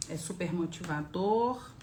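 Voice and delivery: ordinary talk, nothing marked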